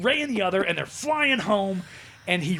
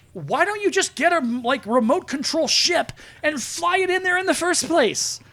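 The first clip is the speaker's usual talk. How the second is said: much higher, slow incredulous complaint; exasperated rant; 11 semitones higher, 5 dB louder, slower than usual. Real, not acted. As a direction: disbelief at a dumb plot choice. Ranting exasperated at the plot.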